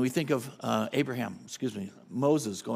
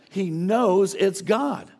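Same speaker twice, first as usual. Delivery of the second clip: very slow, higher and much louder; emphatic preaching; 7 semitones higher, 9 dB louder, much slower than usual. Real, not acted. Pastor insisting to the congregation, very slow and loud. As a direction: stretch each word out, loud. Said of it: "preaching at full force".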